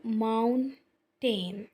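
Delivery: Mountain slowly split into two syllables. Shown as a demonstration. Teacher demonstrating tongue movement, mountain in two slow syllables. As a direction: draw out both halves of mountain. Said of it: "अगर मैं पढ़ती हूँ".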